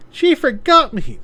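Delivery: put-on high little kid complaining to dad; high child voice. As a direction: pout it like a hurt child. Child voice in scene.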